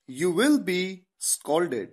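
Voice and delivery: very slow, word by word; slow dictation English; very slow model sentence. Shown as a demonstration. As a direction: spell the sentence out slowly for students. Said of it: "teacher models English sentence".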